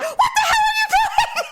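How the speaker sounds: very high, loud mock-panicked shout; imitated frantic yelling; husband yelling. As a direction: holler in disbelief as an alarmed husband. Voices husband yelling at her.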